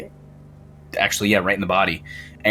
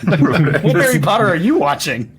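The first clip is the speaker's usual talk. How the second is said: laughing; 6 semitones higher, 5 dB louder than usual. Real, not acted.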